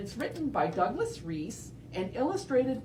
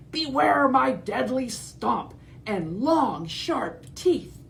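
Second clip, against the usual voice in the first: slow, higher hammed-up threat; menacing dinosaur; 5 semitones higher, 3 dB louder, slower than usual. T. rex threatening Edna, slow hammed-up menace. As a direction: drag out the menace.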